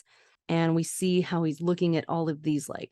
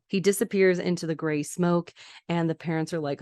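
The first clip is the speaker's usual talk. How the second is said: usual speaking voice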